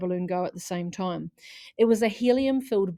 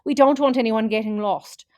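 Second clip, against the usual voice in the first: louder sing-song caution; loud reminder; 3 semitones higher, 8 dB louder than usual. Acted. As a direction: carry the warning over the crowd. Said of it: "sang Missus McLeod".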